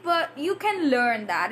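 ordinary talk

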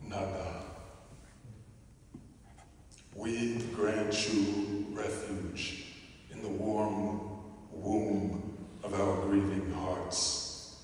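poem recital. Acted. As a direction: lay each name down gently, like a prayer.

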